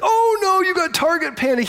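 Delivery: hammed-up high cry of mock dismay; mock alarmed [name]. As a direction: squeal it like a knowing friend. Shop owner [name] reacting.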